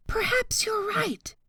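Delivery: soft, high hushed agreement; first boar agreeing. A narrator's reading. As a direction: concede quietly.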